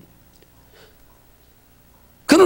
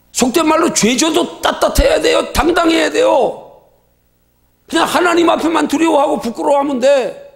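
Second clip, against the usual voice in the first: raised preaching shout; exhorting shout; 3 semitones higher than usual. Real, not acted. Preacher urging the congregation to be bold, shouting. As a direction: call it out to the whole church, strong.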